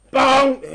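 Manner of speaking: a loud, blunt sung bong; rigid thunder bong. Shown as a demonstration. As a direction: boom it out mechanically like a metronome. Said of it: "demonstrating the rigid thunder".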